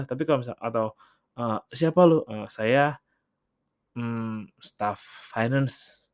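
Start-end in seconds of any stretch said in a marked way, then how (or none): 1.7-2.3 higher, louder challenge: who are you; blunt louder demand. Retold or imitated. Acted blunt question, louder. 2.3-6.0 slow, halting, unsure reply; hesitant answer. Retold or imitated.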